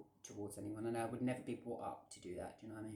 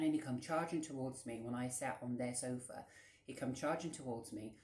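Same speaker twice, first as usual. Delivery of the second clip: ordinary talk, nothing marked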